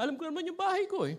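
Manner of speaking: put-on high, quick little kid's protest; child's reply. Child insists to the tanod he knows his house, high and fast. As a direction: rattle it off like a defensive child. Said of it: "Sabi niya".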